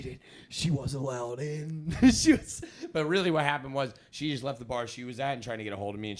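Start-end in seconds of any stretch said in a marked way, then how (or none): none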